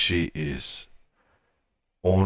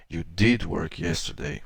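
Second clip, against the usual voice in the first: ordinary talk, nothing marked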